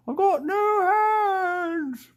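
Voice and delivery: high, slow, muffled wail as if through an apple; handless Lego guy wailing. Pretend. Vlogger voicing a Lego man with an apple in his mouth, high wailing cry. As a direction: cry it out like a toy with no hands. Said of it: "this handsless guy with an apple in his mouth going".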